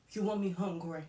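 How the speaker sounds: normal talking voice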